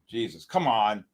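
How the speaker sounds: ordinary talk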